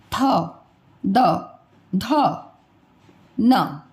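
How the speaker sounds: थ, द, ध, न एक-एक करके, जीभ दांत पर; हर वर्ण अलग, दांत से छूकर; dental letters demo. Shown as a demonstration. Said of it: "स्पर्श किया ना दांत को". (दंत्य वर्ण धीरे बोलना)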